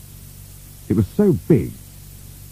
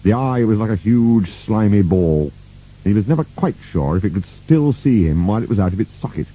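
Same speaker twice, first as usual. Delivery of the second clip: plain reading voice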